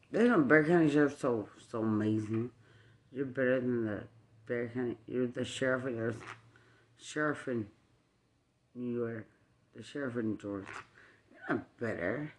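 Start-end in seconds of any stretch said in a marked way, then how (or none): none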